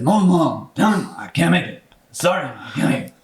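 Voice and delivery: lightly voiced mock mumble, words run together; hard-to-understand Moses Malone. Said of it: "you can barely understand a word he says".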